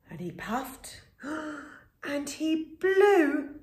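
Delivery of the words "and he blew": slow, high, strained blow; blowing hard. A narrator's reading. Wolf blowing.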